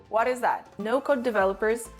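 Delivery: usual speaking voice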